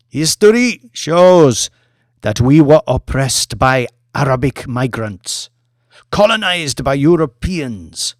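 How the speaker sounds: a showwoman working a hall of presidents; Mamadou's grand address. Acted. Mamadou's speech on oppression.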